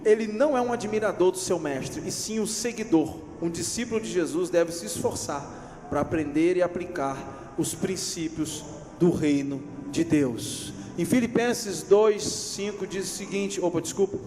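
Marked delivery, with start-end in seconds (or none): none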